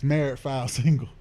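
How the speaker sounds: normal talking voice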